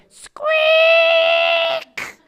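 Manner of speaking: very high loud squeak; squeaky gate. Retold or imitated. Storyteller to children, gate squeak sound effect. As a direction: imitate a creaky gate hinge.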